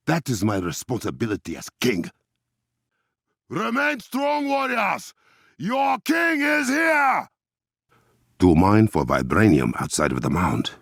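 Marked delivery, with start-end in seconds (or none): 3.5-7.3 high, slow royal battle cry; commanding king's call; rallying shout. Acted. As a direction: lift the warriors' spirits. King rallying warriors.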